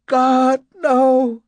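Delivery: high, loud terrified scream. A narrator's reading. Woman sliding up the hall from him, screaming. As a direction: scream it in horror. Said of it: "She was screaming".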